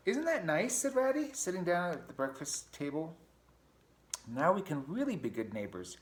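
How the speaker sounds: Ratty breezily moving in as a neighbor. Acted. Ratty to Mouse about sharing everything, high and chirpy.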